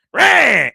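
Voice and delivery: short, high, loud mock roar; monster roar. Dad jumps down from the balcony, mock roar. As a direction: roar it like a leaping creature.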